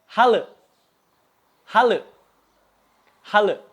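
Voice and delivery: "hale" ending in an unrounded "eu" sound; Sundanese-like e. Shown as a demonstration. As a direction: say "hale" as halı, with dotless ı at the end. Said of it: "mirip seperti huruf E di bahasa Sunda". ("hale" dengan bunyi eu Sunda di akhir)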